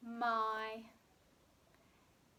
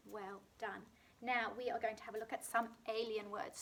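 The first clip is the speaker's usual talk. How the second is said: normal talking voice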